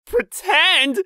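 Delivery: high, loud exclamation; startled realization. Acted. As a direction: burst out as it dawns on you. Realizes proposal was only pretend.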